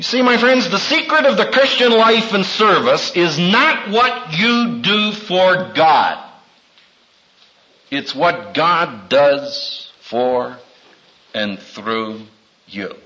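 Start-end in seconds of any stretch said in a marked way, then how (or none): 4.4-13.0 slow emphasis. Real, not acted. Emphatic sermon point.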